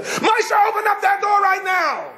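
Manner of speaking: high, frantic shouting through the door; yelling at husband. Retold or imitated. Locked-out wife yells at husband through door, high shout. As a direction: pound on the door with your voice.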